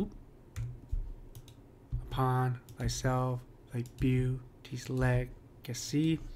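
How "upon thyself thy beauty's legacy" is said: slow "upon / thyself / thy beauty's legacy", paused between feet; broken into iambic feet. Shown as a demonstration. Teacher showing perfect iambic pentameter, broken into slow feet. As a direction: beat out "upon thyself, thy beauty's legacy" foot by foot, weighting every second syllable. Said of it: "if we just break these apart".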